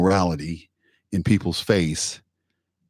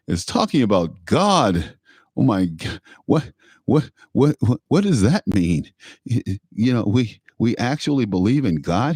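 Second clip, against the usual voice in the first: hammed-up scandalized critics, higher and stammering; mock upset; 4 semitones higher than usual. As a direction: imitate shocked objectors, sputtering in disbelief. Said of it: "they really get upset".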